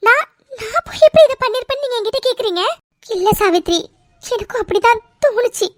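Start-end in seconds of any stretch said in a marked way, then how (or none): 0.1-2.6 higher, hurt disbelief at the accusation; stunned that mother would ask this; shocked protest. Acted. Daughter protests to her mother, shocked and hurt.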